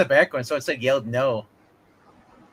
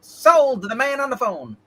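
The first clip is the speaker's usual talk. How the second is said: auctioneer; 10 semitones higher, 3 dB louder, slower than usual. Pretend. Mock auction, closing the sale.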